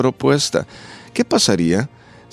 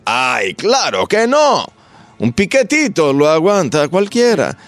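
slow, higher, dismissive sneer at the trick; mocking skeptic; 6 semitones higher, 4 dB louder, slower than usual. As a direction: play the scoffing doubter, drawn out. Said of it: "hay gente que va a decir".

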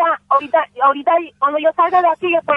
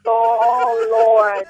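ordinary delivery

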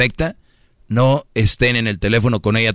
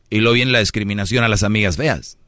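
ordinary talk, nothing marked

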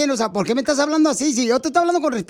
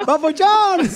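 high, louder, laughing out the nickname; laughing call; 4 semitones higher, 3 dB louder, much slower than usual. Real, not acted. Prankster greets his victim, laughing. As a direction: cry the nickname through a laugh.

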